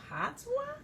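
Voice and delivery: put-on high, rising puzzled little girl; puzzled child. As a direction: try out the strange word. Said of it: "Princess Primrose says".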